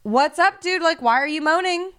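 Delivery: mock-confront a noisy husband at night; worked-up, fed-up retelling; exasperated confrontation. Retold confrontation with moaning husband.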